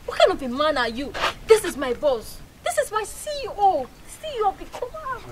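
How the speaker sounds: high, loud frantic rebuke; wife shrieking at husband about her boss; frantic scolding. Acted. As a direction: shriek it, rising in panic.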